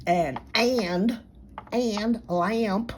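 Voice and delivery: "and" and "lamp" stretched to ay-und, lay-ump; Miss Niche's American vowel. Retold or imitated. Mimicking the channel lady, "and", "lamp" as ay-und, lay-ump. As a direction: break "and" and "lamp" into two syllables. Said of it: "The way Miss Niche says".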